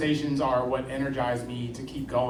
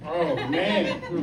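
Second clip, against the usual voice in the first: laughing; 4 semitones higher than usual. Real, not acted. Called on first, laughs.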